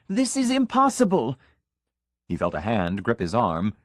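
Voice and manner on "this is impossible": cried out much higher; very high cry. A narrator's reading. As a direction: cry the name up high in protest. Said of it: "his voice very high".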